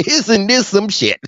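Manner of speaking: high, worked-up disbelief; incredulous outburst. Retold or imitated. Scolding politicians who ask taxed people for money, high incredulous outburst. Repeating own reaction, worked up.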